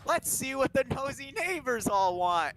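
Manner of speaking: high, slow, mock-sinister line; menacing mock voice. Dennis heading to the neighbors with a poker, slow mock menace. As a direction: draw it out like a threat. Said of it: "And he's like".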